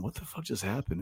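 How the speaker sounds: barely voiced, in shock; dazed muttering. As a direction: alone in his room, dazed and quiet. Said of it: "just going".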